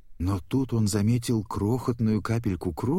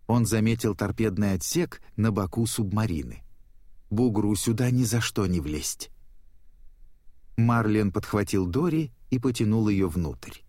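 usual speaking voice

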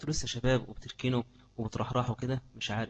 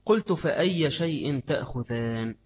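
hadith recitation; 3 dB louder, slower than usual. Acted. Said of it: "لقول سعر بن ديسم".

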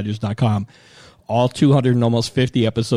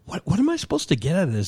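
baffled; 8 semitones higher, faster than usual. Retold or imitated. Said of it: "And I was like".

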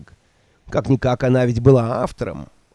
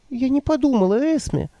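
put-on higher, timid old woman voice; meek old woman; 11 semitones higher than usual. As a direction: answer meekly, eager to please. Nanny voiced by narrator.

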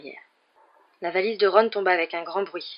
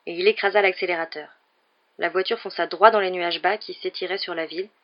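usual speaking voice

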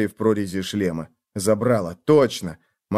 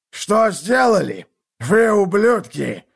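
slow, higher, lisping rage; furious lisp; 9 semitones higher, 3 dB louder, slower than usual. Acted. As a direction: spit the words out in fury. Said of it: "зашепелявил он с такой яростью".

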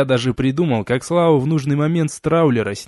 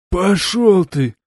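slow, sighing street thug voice; sighed insult; 5 semitones higher, 4 dB louder, much slower than usual. A narrator's reading. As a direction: sigh it out, defeated but rude. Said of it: "вздохнул тот". (со вздохом, грубо)

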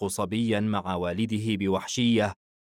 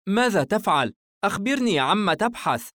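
high, insistent mother's voice; motherly demand, pitched up; questioning mother; 11 semitones higher, 5 dB louder than usual. Acted. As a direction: quiz a child who is making a mess. Mother questions rummaging child.

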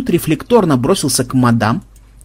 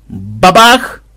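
loud, raised spoken bang; imitated bang; 4 semitones higher, 5 dB louder than usual. A narrator's reading. Narrator imitating the slap landing, explosive bang. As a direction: slam the word out like a blow.

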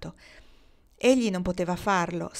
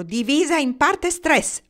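high, loud, drawn-out shout; slow, forceful shouted recitation; shouted Latin; 8 semitones higher, 6 dB louder, slower than usual. A narrator's reading. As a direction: yell it like a proclamation. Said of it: "urlò loro".

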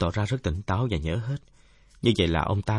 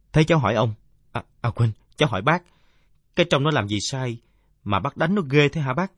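lightly voiced kid, pitch raised; questioning youngster; 5 semitones higher, 5 dB louder than usual. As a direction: politely challenge an elder in a higher voice. (giọng đứa cháu hơi cao, chất vấn lễ phép)

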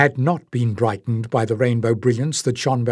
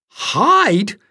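incredulous; 9 semitones higher, 3 dB louder than usual. A narrator's reading. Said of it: "Jasmine exclaimed".

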